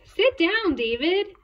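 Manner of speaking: loud, high teacher's command; scolding teacher. A narrator's reading. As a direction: order a pupil like a cross teacher. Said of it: "David's teacher always said".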